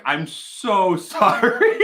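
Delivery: giggling through the sorry, slowed down; laughing apology. Real, not acted. Host laughing at his own joke, apologising to viewers.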